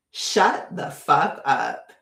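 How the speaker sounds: slow, drawn-out mock tirade at idiots; imitated telling off. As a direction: spell out each insult slowly. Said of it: "That's what I wanted to say".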